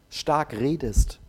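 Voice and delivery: ordinary talk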